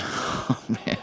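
whispered "oh man"; a hushed, weary sigh of words; exasperated whisper. Retold or imitated. A preacher voices Jesus sighing at Peter in a whisper.

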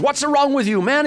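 very high-pitched mock scolding; angry yelling. Highway patrolman scolds a tailgating driver, angry mock yell. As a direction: imitate an exasperated cop yelling. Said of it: "started yelling at Doug".

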